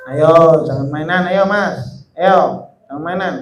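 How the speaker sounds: low, soft real reminder to behave; quiet admonishing aside. Real, not acted. Teacher to a boy playing during the lesson, low quiet aside. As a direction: drop the voice and gently urge him to stop.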